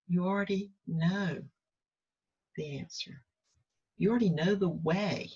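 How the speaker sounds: soft, calm inner voice of God; quiet inner voice. Retold or imitated. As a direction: speak as a gentle inner guide. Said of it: "I heard".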